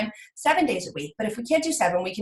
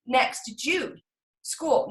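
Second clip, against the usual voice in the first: normal talking voice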